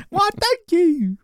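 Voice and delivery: very high, slow, loud mock Cockney; Cockney accent. Shown as a demonstration. Showing off a voice to the co-host, exaggerated Cockney. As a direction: stretch it out in squeaky comic Cockney. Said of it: "I do my Cockney accent".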